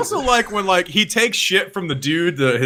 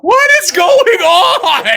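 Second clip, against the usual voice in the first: high, loud, slow shout of disbelief; 5 dB louder, much slower than usual. Real, not acted. Cohost shouting in mock disbelief at the singing. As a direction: yell it out in amused shock.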